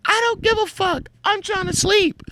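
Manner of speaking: very high falsetto complaint; squealed mock indignation; mimicked irate friend. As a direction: mimic an outraged sleeper in falsetto. Acts friend arguing over the light.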